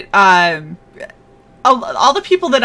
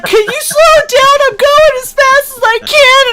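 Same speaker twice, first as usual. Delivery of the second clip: high, strained mock complaint; mock pleading yell; 4 dB louder than usual. Friend with one leg calling after her, high mock-distressed yell. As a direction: cry out in hammed-up protest, pitched way up. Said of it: "he's like".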